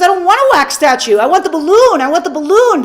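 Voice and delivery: put-on stamp your foot like a small boy wanting a balloon; whiny little boy. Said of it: "And little boy goes".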